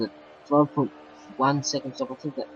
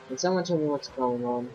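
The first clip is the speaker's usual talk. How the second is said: ordinary talk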